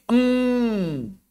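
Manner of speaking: Em hummed long and high through the nose; overdone nasal mim. Shown as a demonstration. Teacher demonstrating the wrong nasal mim sukun, high hum. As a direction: show the wrong, dragged-out nasal em. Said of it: "nggak perlu begini".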